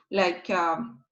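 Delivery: normal talking voice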